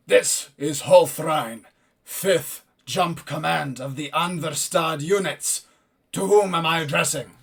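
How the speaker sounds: powerful, slow commanding voice; loud, formal military commander; Holthrein. Acted. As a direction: boom it out word by word. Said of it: "a powerful voice".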